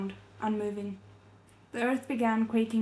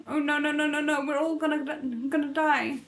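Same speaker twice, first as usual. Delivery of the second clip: higher, panicked wail; 5 semitones higher than usual. A narrator's reading. Master Ma cowers from the corpses, panicked wailing. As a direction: whimper that everyone's doomed. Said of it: "merchant's wailing".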